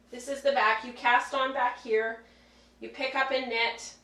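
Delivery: echoey, away from the mic; echoey room sound. Real, not acted. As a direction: speak from across an echoey room. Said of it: "Sorry for the echoey sound 'cause I've moved away from the microphone".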